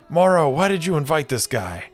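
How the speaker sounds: a hint of grumbling table mates; complaining players. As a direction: whine at Morrow. Annoyed card players complain.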